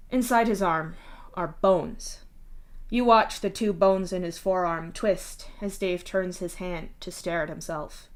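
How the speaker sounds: usual speaking voice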